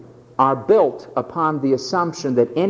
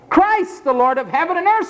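exalted proclamation; 10 semitones higher than usual. Acted. Preacher exalts Christ.

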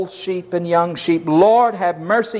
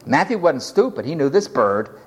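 normal talking voice

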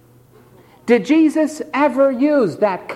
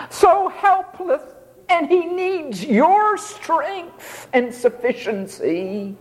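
mock helpless; 7 semitones higher, slower than usual. Picturing a helpless begging Jesus.

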